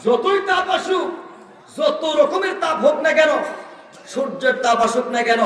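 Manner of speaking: high, shouted proclamation; emphatic preaching. Real, not acted. Preacher dismissing the heat of judgment day, high emphatic preaching. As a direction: throw each phrase out high, then pause.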